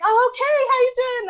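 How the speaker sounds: high, loud, bubbly greeting; Nolan's cheery greeting. Retold or imitated. Imitating Nolan greeting her at the photo booth, high and bubbly. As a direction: chirp a big friendly hello.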